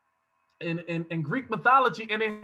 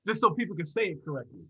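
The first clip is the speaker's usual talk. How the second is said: usual speaking voice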